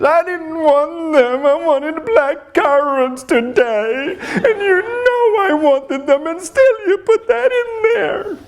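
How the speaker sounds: put-on very high, slow, crying child's whine; whiny toddler voice. Son complaining to dad about the wrong berries, high crying whine. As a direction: wail it like a sulking little kid. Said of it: "And I'm like".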